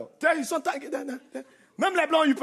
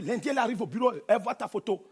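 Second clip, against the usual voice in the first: normal talking voice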